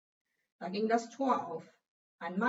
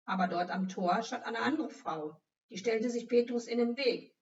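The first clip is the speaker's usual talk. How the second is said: ordinary delivery, nothing marked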